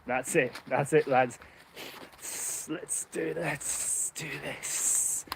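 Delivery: sly snake voice that goes slow and soft by the end; a scheming snake rallying the lads, drawn out; snake impression. Vlogger voicing snakes that have waited for their chance, slow sly snake voice. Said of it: "the best snake impression you're probably gonna hear today".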